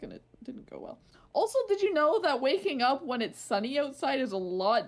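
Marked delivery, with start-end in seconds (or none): 0.0-1.0 breathy whisper trailing off. Real, not acted. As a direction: let it fade to a whisper. Tired story, trails off unvoiced.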